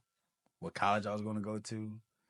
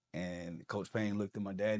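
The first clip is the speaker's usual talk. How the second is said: ordinary delivery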